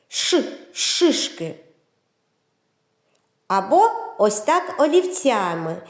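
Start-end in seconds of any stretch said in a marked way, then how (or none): 0.1-1.6 Ш hissed, then шишки with drawn-out sh; stretched sh. Shown as a demonstration. Teacher stressing the sound for children, hissed Ш in шишки. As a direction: draw out the Ш in шишки. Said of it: "викласти букву Ш шишками".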